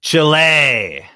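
Chile said as CHEE-leh; Spanish Chile. Shown as a demonstration. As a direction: Chile with a Spanish vowel at the end. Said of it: "How do you pronounce the country of Chile".

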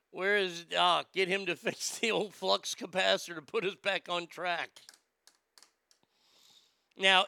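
ordinary talk, nothing marked

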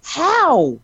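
high, incredulous marvelling; stunned by the writing, how how; amazed. Real, not acted. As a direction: squeal how in disbelief.

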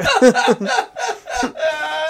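high, helpless laughter. Real, not acted. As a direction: laugh at your own blunder. Laughing at his own story.

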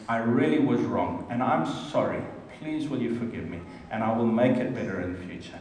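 low, soft, tender apology; sincere apology. Shown as a demonstration. Husband demonstrating an apology to wife Bronnie, low and tender. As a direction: say sorry gently from the heart. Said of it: "So I would say to Bronnie".

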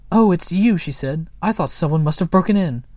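high, loud startled cry. A narrator's reading. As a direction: blurt it out in alarm. Moomin Mama startled at night.

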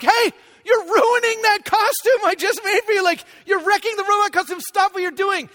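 acting out a dad's high-pitched protest; exasperated dad scolding. Acted dad scolding his son.

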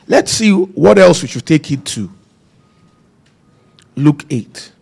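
ordinary talk, nothing marked

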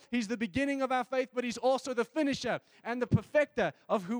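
loud, high, building fervent preaching; preaching at full force. Acted. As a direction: rise into a passionate shouted sermon.

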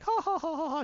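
high mock villain chuckle; gloating laugh to himself. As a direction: laugh to yourself like a schemer. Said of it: "to himself".

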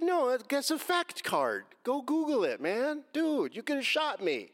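high, drawn-out, emphatic retort; retold indignant comeback. Driver answering officer's race card remark, indignant high comeback. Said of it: "I said".

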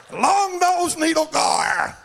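high, long-drawn shout; hammed-up announcement of a catch; showman's holler. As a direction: stretch the name out in a high holler. Calling out the fish's name.

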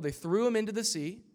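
ordinary talk, nothing marked

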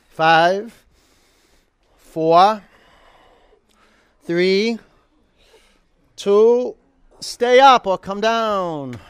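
projected coach's count, climbing in pitch; loud, rising, spaced-out count; loud countdown. Real, not acted. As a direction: call the count out over the class. Coach counting class through hard hold.

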